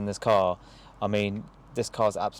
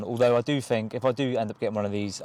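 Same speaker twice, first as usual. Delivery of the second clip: ordinary talk, nothing marked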